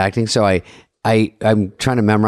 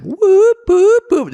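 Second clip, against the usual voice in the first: very high, loud mock siren whoops; imitated alarm whoops; 4 dB louder, much slower than usual. Actor's inner alarm on surprise blocking, whooping.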